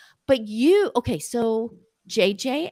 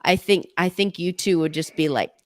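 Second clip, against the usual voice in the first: ordinary delivery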